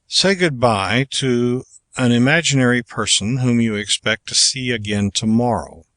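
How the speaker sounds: normal talking voice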